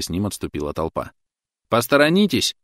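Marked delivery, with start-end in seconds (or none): 1.7-2.6 high loud referee call. A narrator's reading. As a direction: order the crowd back like a referee. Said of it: "тоном рефери потребовал Клод".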